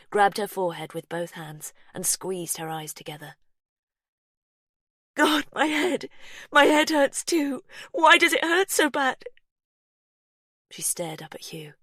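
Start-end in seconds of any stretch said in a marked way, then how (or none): 5.1-9.2 high, loud cries of pain; mother in labour crying out in pain, loud and high. A narrator's reading. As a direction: wail from a splitting headache. Pippa in pain during labour.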